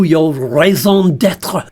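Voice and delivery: slow mock French; host teasing the puppet in French accent. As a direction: drawl it in bad French. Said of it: "stop it with the Frenchy accent".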